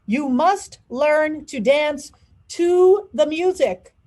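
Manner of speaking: exasperated teacher yelling counts at a student. A narrator's reading. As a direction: bark corrections across the studio. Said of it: "Mister Debato yelled".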